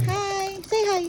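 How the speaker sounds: ordinary delivery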